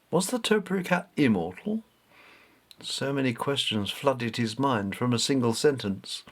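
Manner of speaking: ordinary delivery, nothing marked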